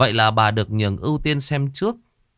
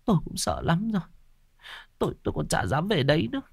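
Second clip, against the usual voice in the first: higher, softer, near tears; teary; 7 semitones higher, 5 dB quieter, faster than usual. A narrator's reading. Frightened wife answering husband, teary and soft. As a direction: hold back tears as you speak. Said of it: "rơm rớm nước mắt".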